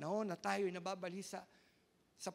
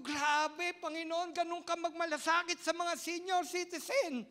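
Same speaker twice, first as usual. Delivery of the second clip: loud, high preaching cry; impassioned preaching; 8 semitones higher, 9 dB louder than usual. Acted. Pastor crying out to the congregation, impassioned. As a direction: proclaim it to the whole congregation.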